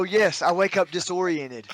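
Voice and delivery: normal talking voice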